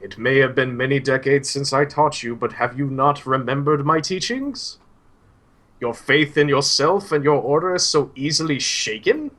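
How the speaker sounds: put-on old Suhail. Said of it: "Suhail kinda turns back to him and he's like".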